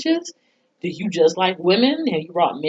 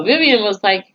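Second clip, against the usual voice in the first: usual speaking voice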